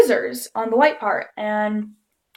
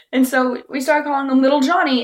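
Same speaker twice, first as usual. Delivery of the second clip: ordinary delivery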